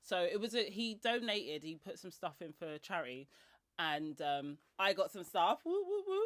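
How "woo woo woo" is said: high happy woo; excited cheer. Real, not acted. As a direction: cheer it out gleefully. Cheering at getting stuff.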